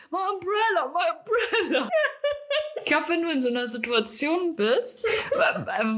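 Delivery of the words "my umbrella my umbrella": high, frantic mock wail about the umbrella; mocking mom's endless umbrella wail, high and giggly; imitating mother. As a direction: repeat it like a worried mom, laughing. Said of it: "Meine Mutter so tausendmal".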